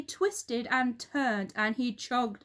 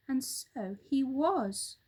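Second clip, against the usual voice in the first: usual speaking voice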